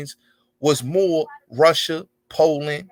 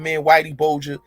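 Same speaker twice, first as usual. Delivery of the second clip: ordinary talk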